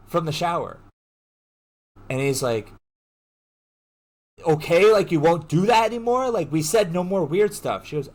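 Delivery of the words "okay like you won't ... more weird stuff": raised, louder mock exasperation; exasperated pleading. Boyfriend asking for no more weird stuff, mock exasperation. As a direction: press for agreement, imitating a fed-up boyfriend.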